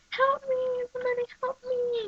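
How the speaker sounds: high, thin plea for help; mock cry for help. Imitating Taya calling from her room, high mock plea. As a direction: squeak out a weak plea.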